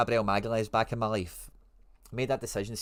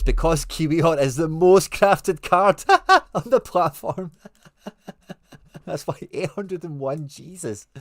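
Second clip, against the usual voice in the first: louder, higher words broken with laughter; laughing; 7 semitones higher, 9 dB louder, slower than usual. Real, not acted. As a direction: laugh through the line. Laughing at the crafted count.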